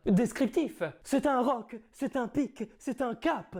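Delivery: theatrical Cyrano declaiming; grand stage verse delivery; hammy stage actor. Acted. Performs Cyrano's nose tirade.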